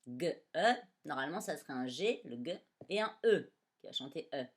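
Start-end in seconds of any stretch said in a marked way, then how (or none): 0.1-0.7 G-E-R spelled out slowly; 「G-E-R」を一文字ずつゆっくり; slow spelling. Shown as a demonstration.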